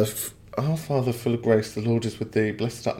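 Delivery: softly muttering the prayer to himself; low murmur of a half-remembered prayer; quiet mumbled recital. Real, not acted. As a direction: mumble the words half under the breath. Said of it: "now I'm doing Hail Mary".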